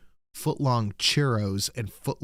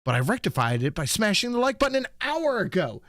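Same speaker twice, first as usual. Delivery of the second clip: higher, excited chat-message read; bright higher reading of a fan's chat; 9 semitones higher than usual. Acted. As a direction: ham up a viewer's message. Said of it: "Steve Dave said".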